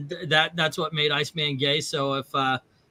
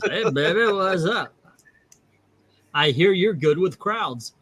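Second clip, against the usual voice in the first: mock-flirting, slightly raised pitch; flirty pickup line; 2 semitones higher than usual. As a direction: deliver a hammed-up come-on, laughing a little. Acting out hitting on Hitler.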